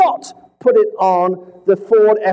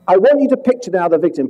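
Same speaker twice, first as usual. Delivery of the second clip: normal talking voice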